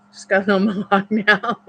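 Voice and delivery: ordinary talk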